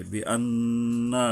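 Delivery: "আন্না" with its doubled noon drawn out through the nose for one alif; ghunnah on the noon. Shown as a demonstration. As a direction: hold the nn of "আন্না" as a long nasal hum. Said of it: "এক আলিফ গুণনা করতে হবে". ("আন্না"-র নুনে এক আলিফ টেনে গুন্নাহ)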